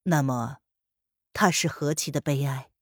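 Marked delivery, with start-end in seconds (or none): none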